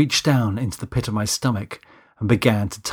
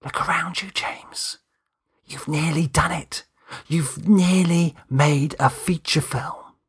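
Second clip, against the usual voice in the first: soft unvoiced whisper, slow; 6 semitones higher, slower than usual. A narrator's reading. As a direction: breathe it as a small inner voice. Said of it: "a small, quiet voice whispered".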